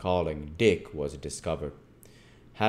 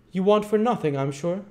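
high, loud, insistent voice; sharp rebuke; 14 semitones higher, 8 dB louder than usual. Acted. Missus Mann to Dick before the beadle, rebuking. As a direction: tell the child firmly, pitched high.

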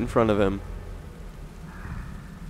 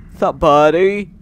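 higher, louder, slow encouragement; cooing at a pet; 8 semitones higher, 8 dB louder, slower than usual. Real, not acted. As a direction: praise it like a good dog. Talking to game creature.

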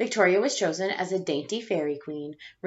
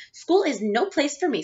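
put-on higher, louder complaining child; upset child; 7 semitones higher, 5 dB louder than usual. Chrysanthemum complaining to her parents, higher upset child voice. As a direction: whine it to your parents.

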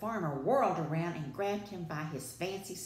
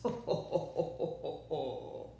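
slow, drawn-out villain chuckle; evil chuckle. A narrator's reading. The wolf gloats over his plan, evil chuckle. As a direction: let evil chuckles drift away. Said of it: "His evil chuckles drifted through the fields".

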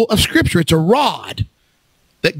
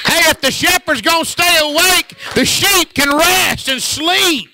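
full-force preaching; 8 semitones higher than usual. Acted. Preacher shouting to his church about the sleepless shepherd, full force. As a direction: shout it out to the congregation like a revival preacher.

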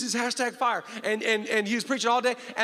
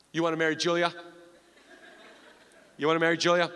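Bob's voice; 5 semitones lower, faster than usual. Retold or imitated. Father-in-law answering the marriage proposal, low and deadpan. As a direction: drop way down and ask it flat. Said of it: "he looked at me and he goes".

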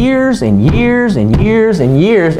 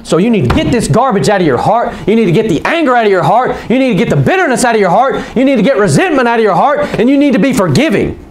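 fast, raised-pitch pulpit repetition; driving preacher exhortation; 5 semitones higher, faster than usual. Real, not acted. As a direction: hammer each demand home without slowing.

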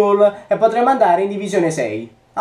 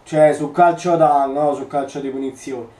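ordinary talk

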